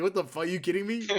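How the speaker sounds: high-pitched comic outrage; mock anger. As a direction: act out an incredulous fan getting mad. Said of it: "you get mad".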